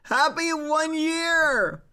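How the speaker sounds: high, slow, squeaky cute voice; cute anime streamer. Acted. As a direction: coo it like a cartoon girl.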